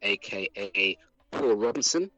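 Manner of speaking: ordinary talk, nothing marked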